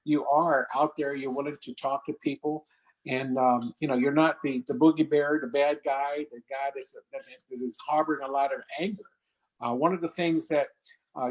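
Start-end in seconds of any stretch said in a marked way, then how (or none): none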